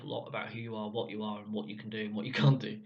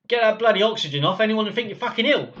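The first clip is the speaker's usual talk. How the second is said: loud, higher brusque order; gruff consultant bark; 8 semitones higher, 10 dB louder, faster than usual. Retold or imitated. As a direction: bark it like a no-nonsense doctor.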